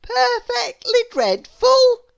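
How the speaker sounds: put-on very high, slow, delighted little girl voice; Little Miss Contrary. A narrator's reading. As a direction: gush over the room as if thrilled. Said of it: "she agreed".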